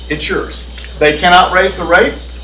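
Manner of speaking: ordinary talk, nothing marked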